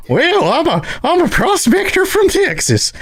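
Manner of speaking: very high, slow Texas prospector voice; a hammy old Texas prospector; Texas prospector accent. Retold or imitated. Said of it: "which isn't the right accent either".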